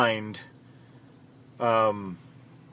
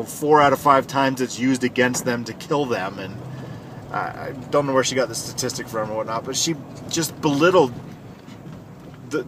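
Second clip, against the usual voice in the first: usual speaking voice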